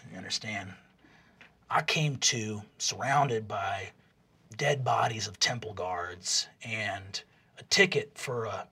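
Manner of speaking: low, soft, musing storyteller; Jawbone, worldly mentor. Acted. Jawbone counseling Rog with a tale of his past, earnest. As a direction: speak low and quiet with long pauses, confiding hard-won advice.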